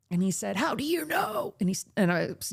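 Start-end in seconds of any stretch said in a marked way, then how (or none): 0.6-1.5 imitating a panicked man, much higher; high anxious mock demand; high yelling imitation. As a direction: fire the question back up high and worried.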